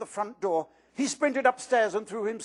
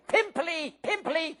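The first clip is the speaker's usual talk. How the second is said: much higher, sing-song jeering yell; yelling taunt; 9 semitones higher, slower than usual. Acted. Peeves jeering after fleeing Myrtle, high yelling. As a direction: chase her with the taunt.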